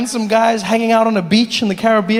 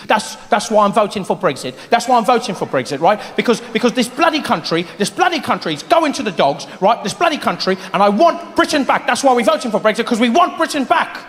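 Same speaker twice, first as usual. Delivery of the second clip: mock British accent, grumbling; a put-on British voter ranting about the country; British Brexit voter. British voter on the news in a mock British accent. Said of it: "I saw people on the news".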